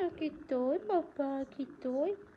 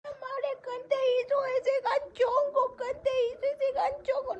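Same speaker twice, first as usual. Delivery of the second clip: mock crying; 10 semitones higher than usual. Mock crying over a hairdo.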